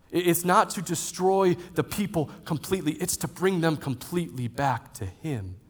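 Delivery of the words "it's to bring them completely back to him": normal talking voice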